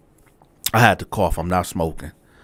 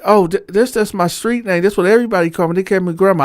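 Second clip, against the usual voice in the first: lightly voiced flustered old man, pitched higher; flustered cellmate; 9 semitones higher, faster than usual. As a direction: play him caught out and apologizing, higher up. Cellmate voiced explaining nickname.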